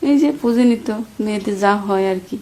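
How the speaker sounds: ordinary talk, nothing marked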